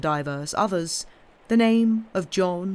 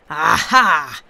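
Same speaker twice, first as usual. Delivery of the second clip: loud exclamation; 10 dB louder than usual. Acted. Stranger bursts in on Jarvie.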